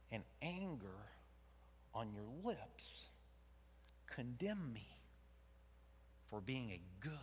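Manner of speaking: soft, slow, half-whispered reproach; quiet intense rebuke. Acted. God confronting Jonah in a soft, slow rebuke. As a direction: lean in low and cutting.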